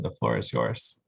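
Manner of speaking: ordinary talk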